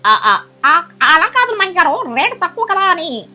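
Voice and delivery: loud, stammering panicked excuses; flustered. Acted. Duck making excuses to the angry eagle, flustered. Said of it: "కుహుబాతు కంగారుగా".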